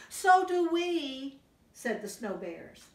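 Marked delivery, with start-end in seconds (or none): 0.1-1.4 high, slow eager cub voices. Acted. Snow bears wanting toast too, high and slow.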